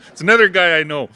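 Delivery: amused aside; laughing. Real, not acted. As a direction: laugh at spotting a friend. Laughs spotting a friend.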